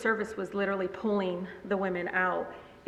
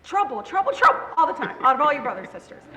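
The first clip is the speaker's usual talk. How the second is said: high, loud mock tirade; exasperated mom voice, jabbing each word; scolding mother; 6 semitones higher, 6 dB louder, faster than usual. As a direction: rattle off a mother's accusation. Imitates mother scolding her.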